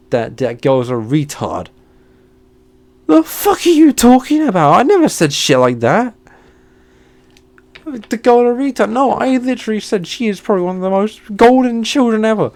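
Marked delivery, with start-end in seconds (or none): 3.1-12.5 angry rant. Real, not acted. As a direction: rant at the teacher who accused him. Ranting at accusing teacher.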